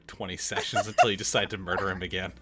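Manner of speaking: fast complaining. Real, not acted. DM jokingly complains.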